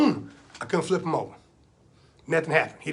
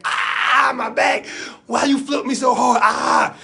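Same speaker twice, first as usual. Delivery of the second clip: loud, high wail of pain; pained yelling; 4 semitones higher, 5 dB louder, slower than usual. Acted. Uncle flipped onto his back, yelling in pain. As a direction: yell out as if hurt. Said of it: "He yelling real loud".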